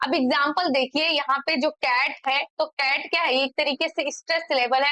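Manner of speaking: normal talking voice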